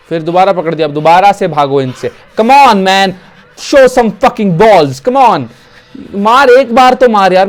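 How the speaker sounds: loud, high-pitched game rage; exasperated yelling. Real, not acted. Gamer urging his character to escape attackers, exasperated yelling. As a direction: yell at your character to fight back.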